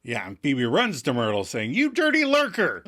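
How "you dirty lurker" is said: high-pitched comic accusation; mock outraged outburst. Phoebe running at Myrtle, mock outraged. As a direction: hurl it as a hammed-up insult.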